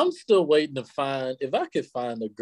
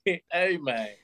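mock Memphis "Hey, man"; amused Memphis-accent imitation; 5 semitones higher than usual. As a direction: stretch "man" with a Memphis twang. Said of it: "Any girl that says "man".

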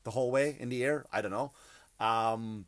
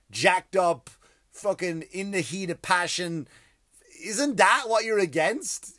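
higher, louder, slowed rhetorical challenge; indignant retort; 6 semitones higher, 6 dB louder than usual. Retold or imitated. Comic mocking liberal page, loud indignant questions. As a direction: hammer the questions home. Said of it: "And then I was like".

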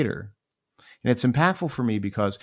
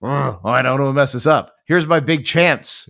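louder, rising panic, afraid to blow it; nervous panic; 4 semitones higher, 7 dB louder than usual. Retold or imitated. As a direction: sound flustered under pressure. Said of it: "And I was like".

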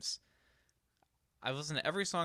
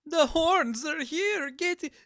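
high, slow, hammed-up British accent; mock British accent, much higher and louder; terrible British accent; 7 dB louder, slower than usual. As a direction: ham up a posh British panic, high and slow. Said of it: "that's a terrible accent".

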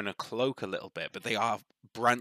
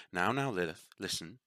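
soothing dryad voice; 8 dB quieter than usual. Acted. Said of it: "the other one goes".

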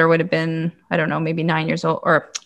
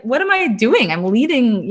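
scared; 6 semitones higher than usual. Retold or imitated. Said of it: "I was so scared".

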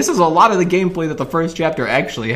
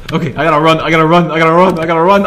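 panicked; 6 dB louder, much faster than usual. Real, not acted. Fleeing Huggy Wuggy.

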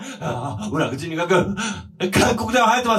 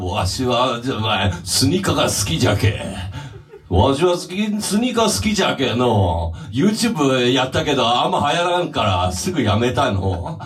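put-on creaky wise old man; 9 semitones lower than usual. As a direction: growl it low like an old local. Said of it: "岩谷修二". (低くしわがれた老人の声)

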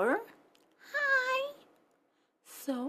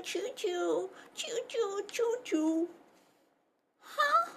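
plain reading voice

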